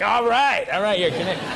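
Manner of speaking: loud, high imitation of a cheer; mock enthusiastic welcome. Actor imitates Canadians greeting a hockey fan, mock excited. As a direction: burst into a hammed-up welcome.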